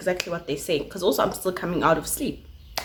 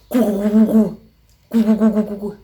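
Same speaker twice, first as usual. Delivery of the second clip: louder buzz of a ringing phone; 6 dB louder than usual. Retold or imitated. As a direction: buzz it out insistently.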